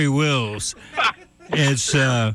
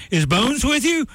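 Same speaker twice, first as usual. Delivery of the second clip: high-pitched imitated call, no real volume; mock yell; 9 semitones higher than usual. Old friend calling into Reagan's elevator, mock yell. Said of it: "he yells into the elevator".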